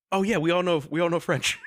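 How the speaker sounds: high, amused mock realisation; playful high-voiced remembering; retold realisation. Acting out the realisation again.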